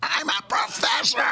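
high shrill mocking screech; mock shriek. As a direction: squeal it in ridicule. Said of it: "mocking the professor's shout".

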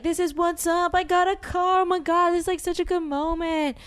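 high, giddy bragging quote; excited celebrating. Retold or imitated. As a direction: gush it out high, as if showing off. Acting out their celebration with the car.

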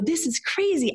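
a burst of disbelieving joy; mock excited. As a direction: gush it like you can't believe it. Excited retold call.

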